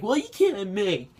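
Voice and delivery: high-pitched, mocking whine; squeaky mock indignation; whiny Twitter bandwagoner. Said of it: "people on Twitter right now are bandwagoning".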